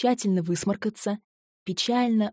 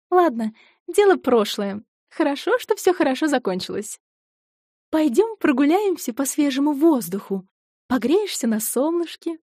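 put-on higher, brighter friendly girl's voice; cheerful young woman voice; 7 semitones higher, 5 dB louder than usual. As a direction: coax a friend out for a walk.

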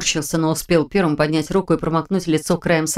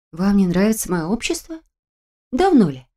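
exaggerated surprise, playfully put on; feigned hurt surprise. A narrator's reading. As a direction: ask as if astonished and wounded. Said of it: "Нарочито удивлённо и обиженно поинтересовалась я".

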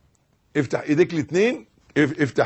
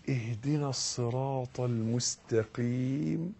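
soft slow supplication; pleading prayer; 4 semitones lower, 14 dB quieter, slower than usual. Shown as a demonstration. Worshipper asking God for guidance, softly pleading. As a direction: ask it softly as a real plea. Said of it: "اطلب، ادعو".

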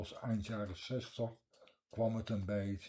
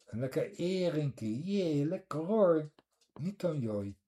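higher, louder, slow Scheveningen dialect with the h dropped; Scheveningen accent; 8 semitones higher, 7 dB louder, slower than usual. Shown as a demonstration. Showing the listener Scheveningen h-dropping, broad dialect. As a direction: savour the herring like a Scheveningen local. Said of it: "Scheveners kunnen de letter H niet uitspreken".

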